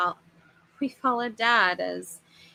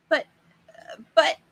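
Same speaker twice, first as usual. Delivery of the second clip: higher, louder stammer that won't get out; sputtering; 7 semitones higher, 5 dB louder than usual. Acted. Flustered father lost for words, loud sputtering stammer. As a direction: trip over the words, flustered. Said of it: "Dad sputtered".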